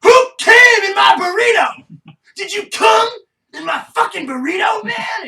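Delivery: high, slow, screaming outrage; outraged scream. Retold or imitated. The couch dude confronting his roommate, an outraged scream. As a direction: scream it, totally disgusted. Retold outraged burrito scene.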